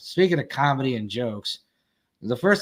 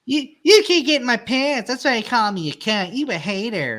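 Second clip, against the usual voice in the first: mock sassy taunt; 7 semitones higher than usual. Woman taunting the insulter.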